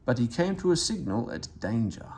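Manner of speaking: ordinary delivery, nothing marked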